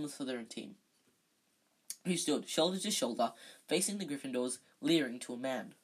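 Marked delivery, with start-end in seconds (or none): none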